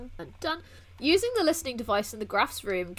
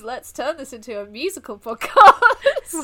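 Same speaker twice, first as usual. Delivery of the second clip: laughing suggestion; 12 dB louder, slower than usual. Real, not acted. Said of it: "Welcome to Reversing Polarity".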